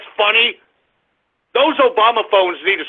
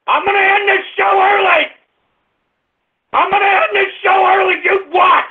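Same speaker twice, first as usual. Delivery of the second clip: angry threat; 9 semitones higher than usual. Real, not acted. Host threatening to end show.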